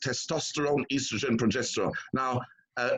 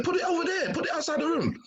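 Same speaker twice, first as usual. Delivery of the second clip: retold insistent order; faster than usual. Retold colleague ordering phone out.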